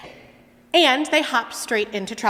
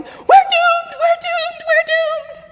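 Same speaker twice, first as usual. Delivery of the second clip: high, loud wailing chant; panicking frog; 10 dB louder, slower than usual. Acted. Frog one in the bucket, shrieking in panic. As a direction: wail it over and over, sure of doom. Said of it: "frog number one is going".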